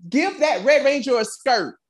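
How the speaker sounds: high, loud indignant plea; exasperated, high-pitched protest; exasperated outburst. Real, not acted. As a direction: insist at the top of your pitch. Exasperated rant about the Red Ranger.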